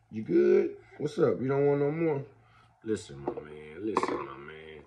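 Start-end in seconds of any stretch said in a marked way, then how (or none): 2.8-4.8 much lower and softer, gentle. Real, not acted. Soft talk to baby.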